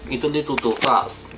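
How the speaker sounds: lightly acted Russian accent. Shown as a demonstration. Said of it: "look, I can do the Russian".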